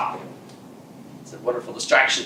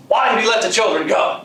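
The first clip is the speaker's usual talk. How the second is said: higher, louder accusing question; angry demand; 7 semitones higher, 3 dB louder than usual. Acted. The witch scolds her underlings, angry higher demand. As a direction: demand an answer from the servants.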